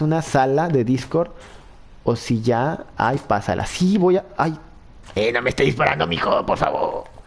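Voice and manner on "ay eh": ordinary talk, nothing marked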